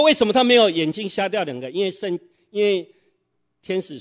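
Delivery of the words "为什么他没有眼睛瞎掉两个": loud, higher, outraged question; indignant outcry. Retold or imitated. Jealous neighbour cries out at his unfair luck, loud and indignant. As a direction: demand it as if cheated. Neighbour angrily asks why.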